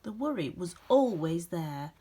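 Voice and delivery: gentle, expressive storybook reading with dramatic pauses; reading to children. A narrator's reading. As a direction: read it like a bedtime picture book for a class. Reads picture book aloud.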